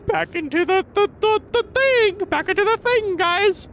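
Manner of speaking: very high cartoonish squeal; mock cheering. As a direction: whoop it up in a silly falsetto. Mock cheering while heading back in game.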